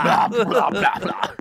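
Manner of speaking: hammed-up retching "blah"; mock vomiting. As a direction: pretend throwing-up noises. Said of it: "I'm just gonna be thrown up constantly".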